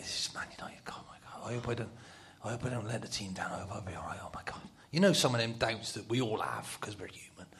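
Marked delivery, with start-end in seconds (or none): 0.0-4.6 whispered, panicky self-talk; nervous whisper. Retold or imitated. Nervous player alone in the toilet before facing Man United, whispering. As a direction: whisper frantic prayers to yourself.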